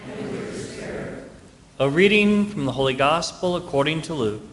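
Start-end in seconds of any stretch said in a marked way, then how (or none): 0.1-1.2 distant congregation response; far-off crowd in a big church; cathedral echo. Real, not acted.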